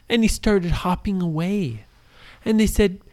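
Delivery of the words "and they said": plain reading voice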